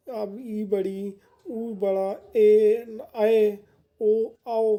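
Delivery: "ई", "ऊ", "ए", "ऐ", "ओ" held long and pushed out loudly; long vowels with force. Shown as a demonstration. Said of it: "इसमें ताकत लग रही है".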